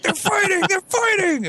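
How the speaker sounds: very high, mock schoolyard chant; excited crowd cry. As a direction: imitate kids spreading the news in a squeal. Imitating crowd spreading fight news.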